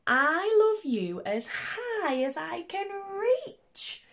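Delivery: very high, slow and stretched, reaching up; little hare voice. Acted. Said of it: "said little nut brown hare".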